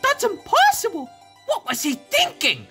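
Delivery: high-pitched incredulous exclamation; shocked disbelief. Acted. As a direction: cry out in disbelief. Shocked reaction to missing friend.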